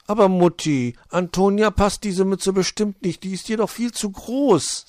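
put-on an honest kid correcting mum; high childlike hedgehog; little hedgehog boy. Borstel corrects his mother.

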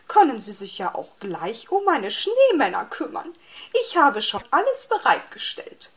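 high, loud, bossy magpie on the phone; busybody bird giving orders; chattering magpie. A narrator's reading. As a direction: prattle on high and insistent, swooping in pitch.

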